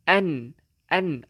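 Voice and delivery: An, an with tongue on the palate; showing where the tongue sits; tongue demo of An. Shown as a demonstration. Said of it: "angkatkan letak di lelangit".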